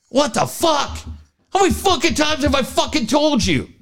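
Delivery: high-pitched exasperated parent tirade; mock heated outburst. Parent losing it at the kids, mock heated rant. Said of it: "there's days when you're pretty heated".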